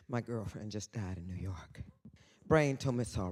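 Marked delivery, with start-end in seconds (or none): 0.1-1.8 hushed secret-telling whisper. Retold or imitated. Whispering a juicy secret to the audience. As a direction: lean in and whisper it like gossip. Confiding aside in a story.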